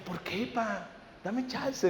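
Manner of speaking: soft, pleading son; mock teen begging softly. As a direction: plead quietly with dad for a chance. Son begging father in story.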